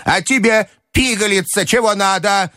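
put-on high, scornful old-lady voice; angry grandmother. A narrator's reading. Grandmother turning on the young mademoiselle, high and angry. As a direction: turn on the next one, pitched high. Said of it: "кричала она на де Грие".

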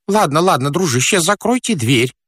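high, harried character voice; flustered fat man. Acted. Stranded fat man calls down. (суетливый толстяк, высоким голосом)

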